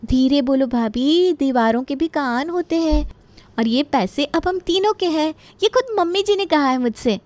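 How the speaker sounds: high, smug confiding without whispering; conspiring daughter-in-law. Acted. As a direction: share the secret with sly triumph. Daughter-in-law confides triumphantly to sister-in-law.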